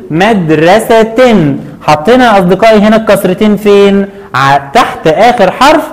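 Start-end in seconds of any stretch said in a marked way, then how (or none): 0.1-1.1 مدرسة ending in a sounded "-tin"; final i plus n sound on مدرسة; tanween kasr ending. Shown as a demonstration. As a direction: say مدرسة carefully as "madrasatin". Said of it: "عند التنوين بالكسر هنقول".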